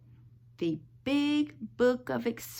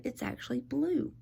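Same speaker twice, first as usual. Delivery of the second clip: ordinary delivery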